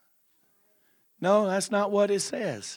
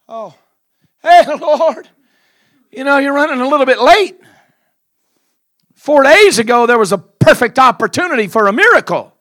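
high, loud, fast laughing scold; scolding Martha; 9 semitones higher, 12 dB louder than usual. Acted. Martha scolds Jesus for arriving late, loud high scolding. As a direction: tease him for being late.

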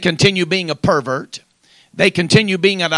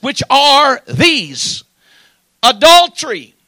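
loud, raised pulpit scripture reading; ringing preacher's voice, higher and louder; full-force preaching; 6 semitones higher, 6 dB louder than usual. Real, not acted. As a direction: proclaim the verse forcefully to the congregation.

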